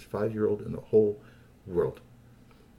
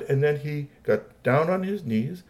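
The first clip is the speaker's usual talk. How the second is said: usual speaking voice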